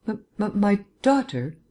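frightened stammering mother. A narrator's reading. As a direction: stumble on the first sound, caught off guard. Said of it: "the woman managed to stammer".

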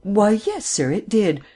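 astonished. A narrator's reading. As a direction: let astonishment lift the pitch. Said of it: "Her apprehension gave way to astonishment".

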